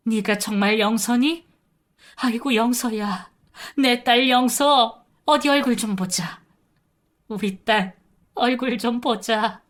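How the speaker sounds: cling to her, crying her name; tearful recognition. Retold or imitated. Mother embracing her lost daughter, tearful recognition. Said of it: "어느새 엄마도 울고 있었어요".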